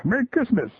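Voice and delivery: put-on bright little-kid "Merry Christmas"; little girl voice. Girl's greeting, shoppers reply.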